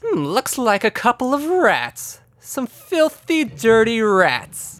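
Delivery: high, drawn-out sneering villainess; villainous woman. Acted. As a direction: savour the insult in a slow, high voice. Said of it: "And she says".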